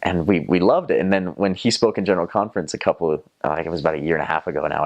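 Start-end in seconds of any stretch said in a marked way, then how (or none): none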